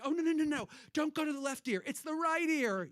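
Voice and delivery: high, loud panicked protest; freaking out. Acted. Comic telling an audience how she begs the pill to change ears, freaking out. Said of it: "I start freaking out".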